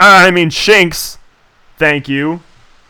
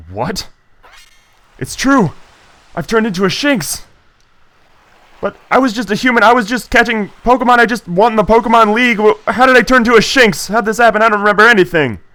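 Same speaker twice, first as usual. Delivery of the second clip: higher, faster alarmed voice; panicked character voice; 6 semitones higher than usual. Acted. Turned into a Shinx, reading his hero's lines in alarm. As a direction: sound shocked at turning into a Shinx.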